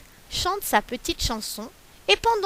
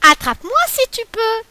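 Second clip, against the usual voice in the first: higher and louder teasing dare; cheeky taunt; 10 semitones higher, 5 dB louder than usual. Acted. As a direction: taunt the rabbit as you run. Galette taunts and flees.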